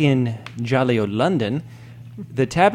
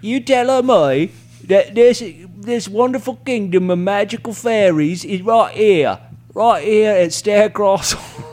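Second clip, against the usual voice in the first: mock English accent; 5 semitones higher, 3 dB louder than usual. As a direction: declaim in a put-on English accent. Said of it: "that's an amazing accent".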